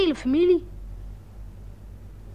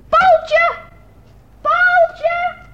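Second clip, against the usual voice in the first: calling out; 12 semitones higher, 8 dB louder than usual. Acted. Child calling friend over wall.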